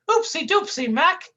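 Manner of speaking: high goofy cartoon whine. Sidekick whining at his mean partner, high goofy voice. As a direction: plead like a hurt buffoon.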